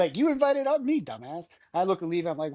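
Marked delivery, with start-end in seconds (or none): 0.2-1.5 mocking retort. Will snaps back at him.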